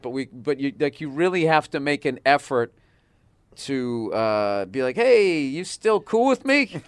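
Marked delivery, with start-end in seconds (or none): 5.0-6.8 slow, high, loud drawn-out appeal; mock check-in. Husband making an effort with his spouse, hammed-up coaxing. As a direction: overdo a needy check-in with your partner.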